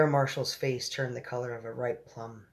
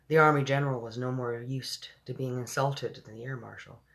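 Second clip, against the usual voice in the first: ordinary delivery, nothing marked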